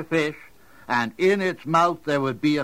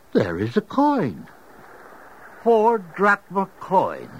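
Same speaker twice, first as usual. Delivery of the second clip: amazed; 8 semitones higher than usual. Acted. Finds coin in fish.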